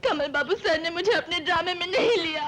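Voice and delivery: crying. Acted. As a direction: sob out the grievance. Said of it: "इसमें रोने की क्या बात है".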